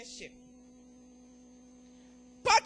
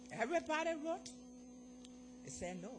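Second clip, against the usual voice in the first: ordinary delivery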